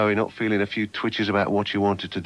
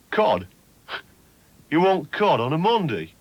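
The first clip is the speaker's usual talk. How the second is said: a hint of scornful East Midlands chip shop voice; sneery East Midlands local; 4 semitones higher, slower than usual. Mocking Long Eaton chip shop staff, mild East Midlands sneer. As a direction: sneer at the order, lightly. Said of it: "Long Eaton's the sort of place".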